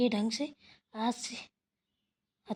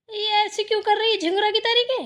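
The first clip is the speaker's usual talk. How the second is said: high, loud commands; impatient scolding; 8 semitones higher, 9 dB louder than usual. Acted. Mother-in-law telling her to stand properly, impatient scolding. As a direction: order her sharply to straighten up and speak.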